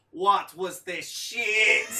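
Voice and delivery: put-on thick Spanish accent, slow and loud; loud, laughing Spanish-accented outburst. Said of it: "very thick Spanish accent".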